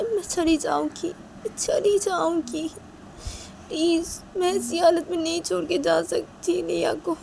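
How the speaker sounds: high, urgent begging voice; pleading Rui. Acted. Rui begging to stay with the sick girl, pleading. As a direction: implore her to let you stay.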